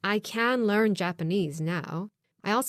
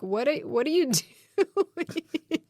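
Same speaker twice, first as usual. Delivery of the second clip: high, laughing imitation of horror; horrified husband; 8 semitones higher than usual. Husband overhearing the audition tape, mock horrified. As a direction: retell his appalled reaction through laughter. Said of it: "he was a little bit horrified".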